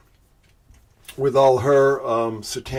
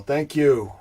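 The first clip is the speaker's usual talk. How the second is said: usual speaking voice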